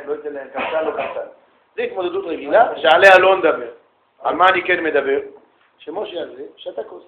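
usual speaking voice

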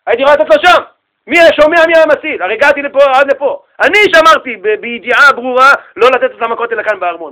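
acted impassioned justification; emphatic self-defence. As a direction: argue your case forcefully to a king. Sage argues back to the angry king.

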